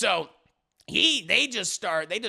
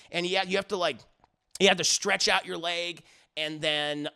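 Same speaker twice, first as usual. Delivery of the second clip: ordinary talk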